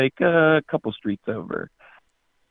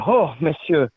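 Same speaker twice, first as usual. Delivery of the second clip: put-on higher comic French accent; 5 semitones higher than usual. French guy in a bar joke, French accent. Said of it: "That's good French".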